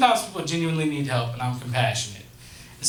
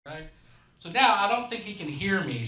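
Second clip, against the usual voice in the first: normal talking voice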